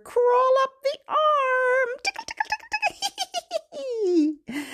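very high squeaky patter; playful tickling. Pretend. Host tickling baby along the arm, squeaky. Tickle game inside the rhyme.

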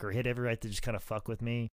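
ordinary talk

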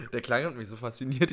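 ordinary talk

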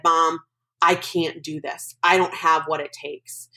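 usual speaking voice

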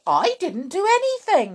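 high, loud denial; protesting innocence. Acted. Husband blamed by his wife, protesting. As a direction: defend yourself indignantly. Mister Cranky denies blame.

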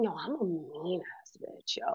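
hushed whisper. Real, not acted. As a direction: whisper it in disbelief. Realizing harsh self-talk.

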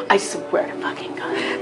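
furious hushed hiss through a whisper; whisper screaming. Retold or imitated. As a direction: rage under the breath, voiceless. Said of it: "whisper screaming".

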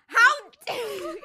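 loud, high mock scream; all-caps screaming. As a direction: imitate a friend's outraged yell. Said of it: "literally all caps screaming at me".

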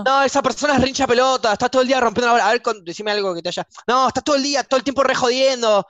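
high-pitched, fed-up complaining; exasperated rant. Acted. Professor complaining about a pestering student, high exasperated rant. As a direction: let the irritation spill out.

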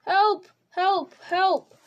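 high, loud desperate calls; shrill cry for help. A narrator's reading. Frodo calls for help down the forest path in desperation. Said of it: "ran along the path crying".

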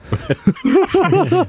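high, loud burst of laughter; real high-pitched belly laugh. Real, not acted.

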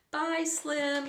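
high, loud goodbye yell. A narrator's reading. Annie yelling goodbye as Slim rides off, high yell. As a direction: yell it after a departing friend. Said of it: "yelled Annie".

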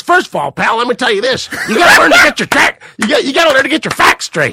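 aggressive Jay Leno. Retold or imitated. Leno scolds the protest organizer, mock angry. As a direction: lecture him like a hothead. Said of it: "really aggressive".

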